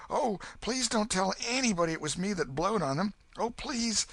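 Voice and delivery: put-on high, urgent pleading boy; exclaimed plea. Huck begging the sons not to tell anyone. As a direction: blurt out the plea, desperate. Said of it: "Huck sprang up and exclaimed".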